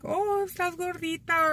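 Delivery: very high sing-out in imitation; teasing relative. Relatives remarking on weight gain, high mock teasing.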